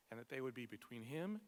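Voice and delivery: ordinary delivery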